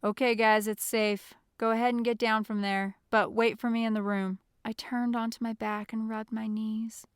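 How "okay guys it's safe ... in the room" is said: loud, projected all-clear; yelling up. A narrator's reading. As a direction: call instructions up to the roof. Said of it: "Jeremy yelled up to us".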